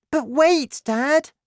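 put-on very high, loud child's voice; young son interrupting. A narrator's reading. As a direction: cut in on Dad as a small boy. Boy tries to interrupt his father.

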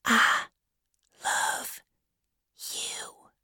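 halting, tender whisper, word by word; sweet whisper. A narrator's reading. Becky confessing her love close to Tom, sweet whisper. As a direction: whisper it sweetly with a pause after each word. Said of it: "she said sweetly".

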